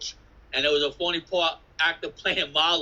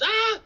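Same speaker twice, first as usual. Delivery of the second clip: high startled crowd shriek; mock crowd scream as the train rushes in; 4 dB louder than usual. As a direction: let out a sudden high yelp of alarm. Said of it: "and everybody's".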